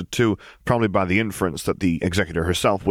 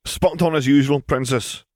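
chipper and irreverent; 7 semitones higher than usual. A narrator's reading. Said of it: "chipper and irreverent".

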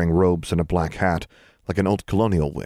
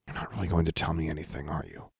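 quiet; 10 dB quieter, faster than usual. A narrator's reading. Resigned aside.

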